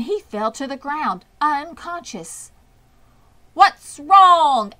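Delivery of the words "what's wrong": much louder and higher, sudden alarm over the speaker; startled announcer shout; alarmed loudspeaker. A narrator's reading. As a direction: call out in alarm across the fair. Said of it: "asked the loudspeaker".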